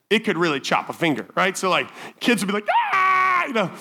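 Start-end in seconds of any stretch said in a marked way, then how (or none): 2.9-3.5 sudden high yelp; startled child's cry; kid's yelp. Retold or imitated. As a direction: yelp like a pinched kid. Kids yelping at the guillotine.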